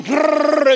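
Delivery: loud, high rolled grrr; imitated scrambling noise. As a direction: rev up like a dog scrambling away. Imitates dog scrambling up hill.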